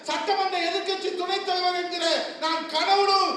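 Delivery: shouted, high-pitched stage speech; fiery rally oratory. Acted. Politician roaring to party workers at the rally. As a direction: thunder it to the crowd.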